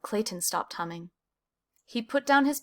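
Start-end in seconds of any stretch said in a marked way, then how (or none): none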